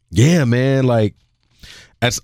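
ordinary delivery

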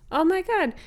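high-pitched concerned exclamation; shelter worker's discovery. Acted. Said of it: "And they're like".

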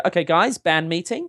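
high New Zealand accent, calling a meeting. Shown as a demonstration. Guest shows the host a New Zealand band manager, New Zealand accent. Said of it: "uh, a New Zealand accent at all".

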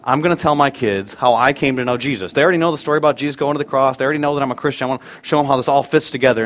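normal talking voice

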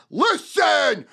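loud, high listen do this fair enough; shouted pitch. Retold or imitated. Salesman performing the full pitch, shouting. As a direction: hammer the three words. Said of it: "So it's".

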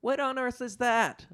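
a shocked high-pitched Buddy; high startled elf cry. Acted. Buddy shocked at the draped body, high cry. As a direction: gasp it out high and shaken. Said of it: "shouted Buddy".

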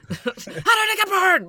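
high, drawn-out mock scream; maniac screaming. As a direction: scream it like an over-the-top maniac. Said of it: "Maniac Island screaming".

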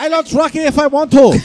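high, loud, laughing broken English; hammed-up defiant broken English; mock broken-English boast. Voicing China, broken English.

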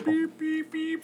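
high beeping robot. Pretend. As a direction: beep like a toy robot.

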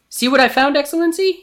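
high, loud underling's voice; eager guard. A narrator's reading. Guard presenting the found horse to Jafar, eager. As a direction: pipe up proudly to your master.

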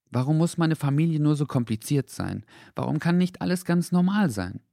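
put-on high boy's voice, plaintive questions; sad boy. A narrator's reading. Henry lamenting his complicated family, plaintive. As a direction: ask why with a child's frustration.

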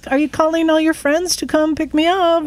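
high-pitched, put-on hello to strangers; mock cheery greeting. Lone woman rider greeting men at a gas station, high mock-friendly.

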